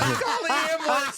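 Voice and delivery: high mock holler for help, amid laughter; retold hollering. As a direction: imitate someone hollering for an ambulance. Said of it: "and he's hollering".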